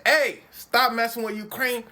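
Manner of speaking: loud mock warning as Trump. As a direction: shout the warning like a brash boss. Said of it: "Donald Trump is saying".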